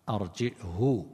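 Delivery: "أرجئه" with a hamza and long final hoo; hamza with ishba'. Shown as a demonstration. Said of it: "بالهمز مع الإشباع".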